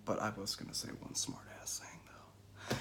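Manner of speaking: conspiratorial whisper; whispered aside. Real, not acted. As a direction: lean in and whisper the confession. Sly aside to the audience.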